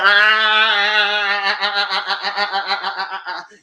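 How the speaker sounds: a loud, high run of warning "ah, ah" that fades out; scolding ah ah ah. Retold or imitated. Yelling at the TV to stop her walking the dog, loud "ah ah". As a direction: stop her with a rising chain of "ah". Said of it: "I said".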